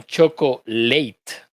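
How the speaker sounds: chocolate said cho-co-LATE, like the word late; mock student reading: choco-LATE; wrong student pronunciation. Teacher imitates students' wrong choco-LATE ending. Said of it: "que decían".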